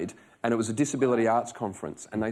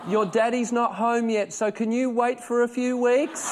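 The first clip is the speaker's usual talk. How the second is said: put-on high, slow little-girl voice to the baby bump; imitating a toddler talking sweetly; daughter's voice; 9 semitones higher, 3 dB louder, slower than usual. Dad imitating his daughter talking to the baby bump. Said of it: "putting her hands up and saying".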